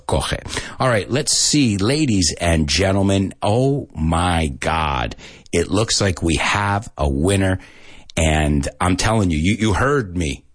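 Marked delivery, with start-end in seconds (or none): none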